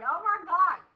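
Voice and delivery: alarmed exclamation; sister shocked. Retold or imitated. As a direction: sister seeing the swollen lips. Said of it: "Mary's like".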